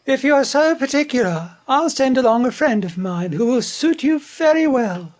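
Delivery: high, huffy magpie voice; cross magpie in a high character voice. Acted. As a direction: pitch it up high, like an offended bird. Magpie's haughty parting retort in story.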